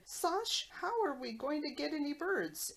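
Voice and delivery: put-on one duck hunter calling to the other in a Polish accent; mock Polish accent. Retelling Mork's duck hunter joke in a mock Polish accent. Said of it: "he does these with accents".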